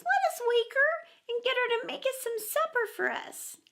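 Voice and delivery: put-on very high little boy; pleading small child; high childish suggestion; Michael's voice. A narrator's reading.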